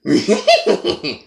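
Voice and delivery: short cartoon dog snicker; Scooby-Doo laugh. Shown as a demonstration. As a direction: give a quick Scooby snicker. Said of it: "One more time".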